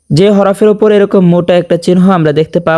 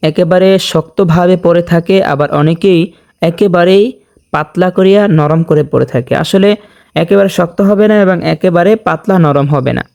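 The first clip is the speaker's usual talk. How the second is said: ordinary talk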